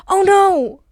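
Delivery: loud high shriek of alarm; sudden alarm. Pretend. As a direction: cry out in sudden panic.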